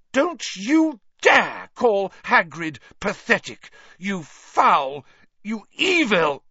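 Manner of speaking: high, slow, emphatic fury; furious. A narrator's reading. Hermione confronting Malfoy for insulting Hagrid, furious. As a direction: spit each phrase out in outrage.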